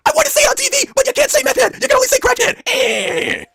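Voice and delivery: mock Braun shout. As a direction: bellow it like you just got woken. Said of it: "saying impression".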